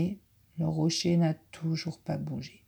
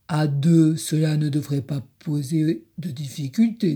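big wolf voice; 2 semitones lower, 5 dB louder than usual. Acted.

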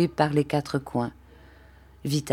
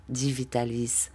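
usual speaking voice

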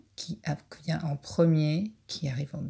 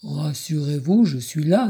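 louder, big wolf voice; big deep voice; 2 semitones lower, 5 dB louder than usual. Acted. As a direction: reassure in a big voice. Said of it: "dit une grosse voix".

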